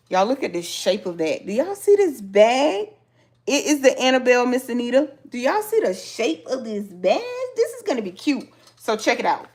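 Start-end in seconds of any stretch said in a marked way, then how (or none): 1.5-8.5 gushing over the bag, pitch up and loud; thrilled, higher and louder. Real, not acted. Crafter showing viewers her new bag, excited and louder. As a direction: show it off like you can't contain yourself.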